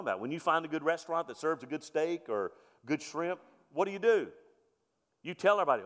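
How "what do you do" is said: normal talking voice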